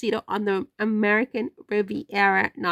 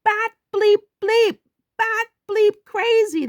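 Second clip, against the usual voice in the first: emphatic high; 11 semitones higher, 5 dB louder than usual. Real, not acted.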